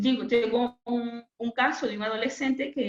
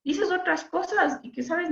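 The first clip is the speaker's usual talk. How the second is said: put-on higher, coaxing question to a teen; gentle probing; 8 semitones higher than usual. The speaker gently questioning the teenage boy about mixing up words, lightly imitated. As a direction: ask kindly, pitched up to sound unthreatening. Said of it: "y le pregunto".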